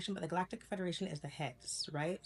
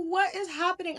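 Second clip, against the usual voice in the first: high, louder frightened question; panicked question; 13 semitones higher, 7 dB louder than usual. Retold or imitated. Kid hears people over the bed, high frightened question. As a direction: blurt it out in rising panic. Retelling panic at strangers in room.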